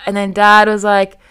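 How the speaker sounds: normal talking voice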